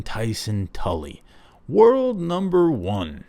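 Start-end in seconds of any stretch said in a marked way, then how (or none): 1.7-3.2 "World number one" slow, high and grand like a ring announcer; hammed-up title billing; announcer intro. Shown as a demonstration. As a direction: announce yourself to the crowd, slowly. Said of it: "make that my own nickname".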